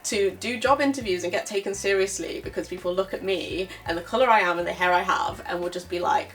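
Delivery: normal talking voice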